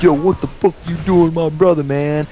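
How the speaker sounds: high, hammed-up street tough voice; mocking the brother. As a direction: imitate the angry brother mockingly. Voicing bully's brother.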